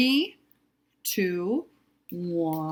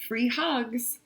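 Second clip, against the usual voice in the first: ordinary delivery, nothing marked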